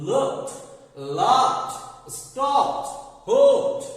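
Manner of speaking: "Looked, laughed, stopped, hoped" each loud, ending in t; final t sound. Shown as a demonstration. Teacher lists verbs for viewers, "Looked" to "hoped" ending in t. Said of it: "উচ্চারণে ট sound চলে আসছে".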